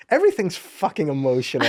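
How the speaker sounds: high, laughing disbelief; retold exasperation breaking into a laugh; amused exasperation. Dad reacting to people crying over an apology, amused exasperation.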